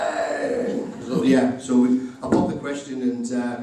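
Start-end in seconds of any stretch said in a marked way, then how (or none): 0.0-0.8 mock cheer. Crowd cheers the proposal.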